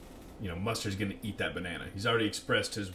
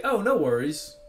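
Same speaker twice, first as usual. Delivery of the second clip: mock cheerful brush-off; 5 semitones higher, 8 dB louder than usual. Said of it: "He's like".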